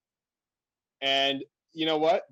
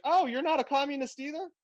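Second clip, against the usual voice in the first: put-on elderly woman; 12 semitones higher than usual. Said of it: "she's like".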